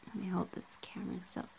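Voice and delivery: quick whispered aside; hushed aside off the mic. Real, not acted. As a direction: drop to a fast whisper while fiddling with the camera. Aside while steadying the camera.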